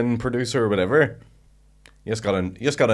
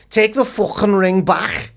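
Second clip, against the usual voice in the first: high, loud, drawn-out Scouse fury; slow, high Scouse row impression; Scouse girl fighting; 12 semitones higher, 7 dB louder, slower than usual. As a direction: imitate a Scouse girl screaming at her husband. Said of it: "copying the girl that".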